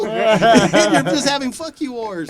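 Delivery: high, loud, laughing while talking. Real, not acted. Laughing at his juvenile hall memories while telling friends. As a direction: let the laughter spill over the words.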